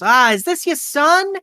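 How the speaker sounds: high-pitched woman's voice, curious; high, friendly diner waitress. Acted. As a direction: ask in a high, gushing waitress voice. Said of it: "a woman comes by and goes".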